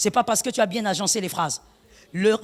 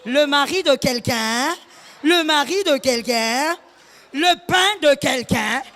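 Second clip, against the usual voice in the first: slow, louder pulpit cry; preaching at full force; 4 dB louder, slower than usual. Acted. Pastor warns the congregation about filming husbands, slow preaching shout. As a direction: hammer each phrase out slowly.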